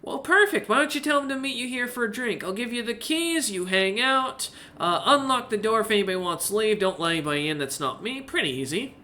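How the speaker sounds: easygoing barkeep, lightly acted; lightly voiced barkeep. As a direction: lightly play an obliging tavern keeper. Game master answers as the barkeep.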